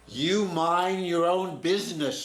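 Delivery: defiant retort. Retold or imitated. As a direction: tell the nosy stranger off. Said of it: "turns and looks at the gentleman and says".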